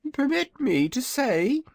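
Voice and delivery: high, proud little dragon voice; a boastful baby dragon, pitched high; young dragonette. Acted. Dragonette speaks to visitors.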